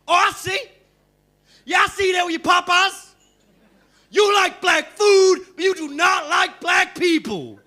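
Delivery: loud, high outraged yell at the mixtape buyer; furious shout. Retold or imitated. Salesman yelling angrily at the comic for rejecting him. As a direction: bellow it out in mock outrage. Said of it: "sheer, unadulterated anger".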